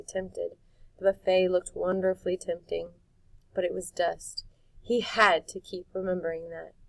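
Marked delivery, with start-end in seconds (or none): none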